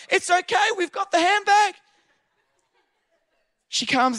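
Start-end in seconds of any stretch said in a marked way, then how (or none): 0.1-1.7 high-pitched mock call to a runner; high calling voice, acted out; imitated yelling. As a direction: call after her, imitating bystanders. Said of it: "everyone else is yelling to her".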